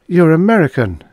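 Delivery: high, loud character voice; female captive's voice. A narrator's reading. Captive addresses fellow prisoner.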